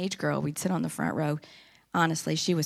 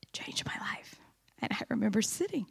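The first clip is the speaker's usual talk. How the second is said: hushed whisper; 3 semitones higher, 5 dB quieter than usual. Real, not acted. Woman telling the church her testimony, whispered. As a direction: drop to a whisper, moved.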